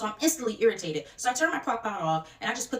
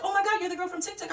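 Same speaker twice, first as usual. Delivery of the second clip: high-pitched mock fan gushing; starstruck recognition; 9 semitones higher than usual. Friend recognizing the girl from TikTok, high starstruck excitement. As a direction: imitate a fan recognizing someone famous.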